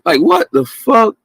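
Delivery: high, slow "what the fuck"; drawn-out outraged disbelief; incredulous. Real, not acted. Outraged at critics.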